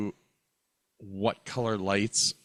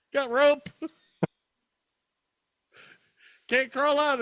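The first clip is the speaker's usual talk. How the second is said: much higher squeaky little voice; tiny trapped voice. Pretend. Continuing the trapped-person joke, much higher squeaky voice. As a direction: plead up from inside, small and high.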